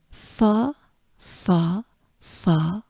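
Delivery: "fo" repeated as separate syllables; syllable drill. Shown as a demonstration. Teacher breaking down a French name, separated syllable drill. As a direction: say "fo" alone, a pause after each. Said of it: "we're gonna work on this part".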